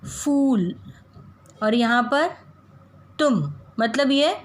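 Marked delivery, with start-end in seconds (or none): 0.0-0.7 "फूल" का ऊ खींचकर ऊंचा बोलें; "फूल" में लंबा, ऊंचा ऊ दिखाएं; long oo demo. Shown as a demonstration. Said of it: "हम उच्चार कैसे कर रहे हैं". (बड़ी ऊ का लंबा उच्चारण)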